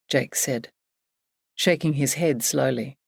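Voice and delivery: ordinary delivery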